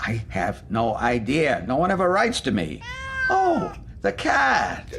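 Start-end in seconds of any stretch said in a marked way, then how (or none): 3.3-5.0 high, slow startled gasp of words; suddenly spot the cat. Acted. Hermit startled by the cat, high slow gasp.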